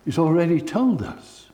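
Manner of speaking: a high, ringing, fervent sermon voice; impassioned preaching. Acted. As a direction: preach it out over the room, rising to a cry. Preaching at full force.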